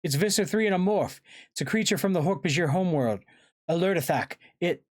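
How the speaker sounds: high, urgent cries, cut off at the end; yelled alarm. A narrator's reading. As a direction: shout a frantic explanation to the others. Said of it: "Axe yelled".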